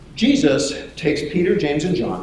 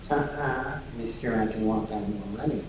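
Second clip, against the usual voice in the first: soft, low teasing mumble; mock him under your breath; hushed, low jeering; muttered mockery; 4 semitones lower, 9 dB quieter than usual. Disciples mock Peter under breath.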